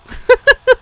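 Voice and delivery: loud, high delighted giggle. Real, not acted. Reader giggling at the kiss scene, high and loud. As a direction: burst into a giggle.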